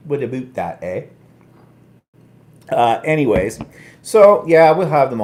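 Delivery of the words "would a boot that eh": put-on Canadian accent. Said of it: "my Canadian accent".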